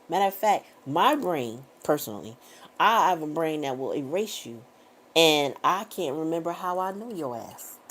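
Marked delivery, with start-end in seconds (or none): none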